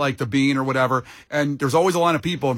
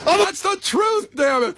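much higher, hammed-up mock line; mock Adrian line. Host parodying Adrian's line for laughs, high and hammy.

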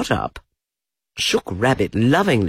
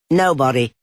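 rabbit voice; 5 semitones higher, 5 dB louder than usual. A narrator's reading. Rabbit hiding, voiced differently.

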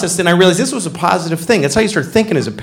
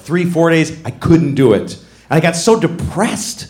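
usual speaking voice

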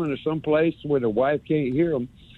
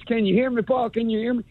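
higher, pleading caller voice; mock eager caller; 8 semitones higher than usual. Caller on hold begging the host to hear him. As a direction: call out like a bad phone line.

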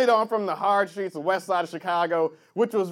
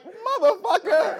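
slow-motion curse; 10 semitones higher, slower than usual. Retold or imitated. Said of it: "The look on his face in s-, slow motion, just like".

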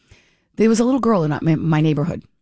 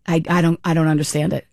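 usual speaking voice

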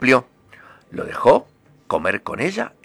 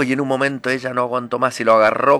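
ordinary talk, nothing marked

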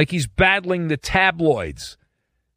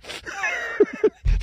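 high laugh; 3 dB quieter than usual. Real, not acted. Laughing at own joke.

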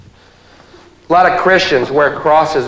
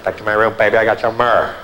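seductive come-on; 6 semitones lower, 3 dB quieter, faster than usual. Acted.

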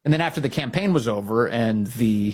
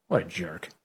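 muttered aside; 8 semitones lower, 7 dB quieter than usual. Real, not acted. Aside insulting Cornyn.

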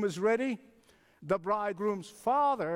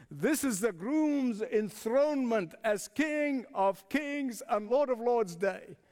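usual speaking voice

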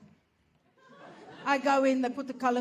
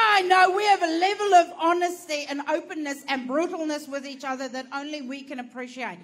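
ordinary talk, nothing marked

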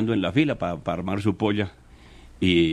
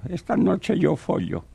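put-on high, slow mumbling old man; 7 semitones higher, slower than usual. Host telling a joke, doing two old men talking in a high old voice. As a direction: play a toothless old man. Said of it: "Dos viejitos hablando".

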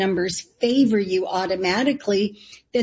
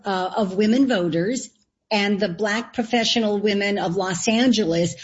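normal talking voice

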